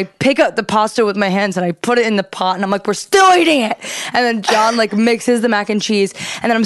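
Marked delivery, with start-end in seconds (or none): 2.9-3.8 high-pitched irritated insistence; retold angry outburst, pitched up; mock pissed off. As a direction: snap it in hammed-up annoyance. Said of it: "now I'm still pissed".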